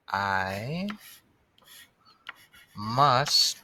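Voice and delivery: each word alone, long pauses; slow dictation. Shown as a demonstration. Writing the sentence word by word.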